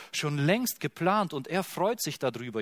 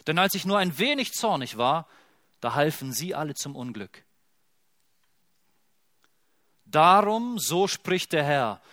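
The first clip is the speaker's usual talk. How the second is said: louder, zealous reading of God's words; fervent scripture reading; 3 dB louder than usual. Acted. Preacher reads God's zeal aloud, fervent and weighty. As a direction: read as if God himself declares it.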